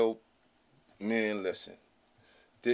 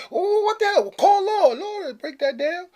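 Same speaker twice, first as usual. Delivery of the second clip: mock panicked caller; 6 dB louder, slower than usual. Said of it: "when they send that letter".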